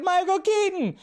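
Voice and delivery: high-pitched reenacted shout; retold yell. Fan retelling his outburst at the party, high yell. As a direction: shout it high, like announcing a hero. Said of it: "I just yelled it out".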